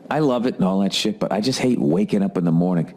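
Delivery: ordinary talk, nothing marked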